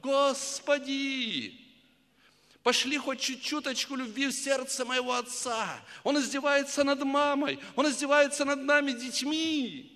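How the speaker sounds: high, loud pleading cry; a boy crying out to God about his cruel father; crying out to heaven. Retold or imitated. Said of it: "он буквально кричал в небо".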